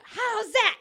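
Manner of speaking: loud, high appeal shout; player's yell. Shown as a demonstration. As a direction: yell it out across the field. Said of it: "If a player yells out".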